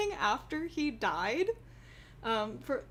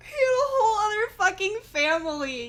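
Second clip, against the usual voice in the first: loud, high and cracking with laughter; laughing outrage; 8 semitones higher, 7 dB louder than usual. Real, not acted. Telling about granddad's other family, laughing hard. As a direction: let the laugh break the words.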